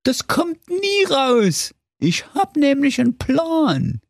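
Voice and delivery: very high, slow, sly wolf. A narrator's reading. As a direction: tease out the plan slyly. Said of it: "sagte der listige Wolf".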